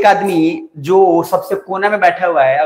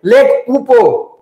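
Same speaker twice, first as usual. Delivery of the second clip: louder, higher, drawn-out call; mocked map teacher; 5 semitones higher, 4 dB louder, much slower than usual. Teacher mocking those who teach by colouring atlases, drawn-out call. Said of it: "वो उधर बोलेगा".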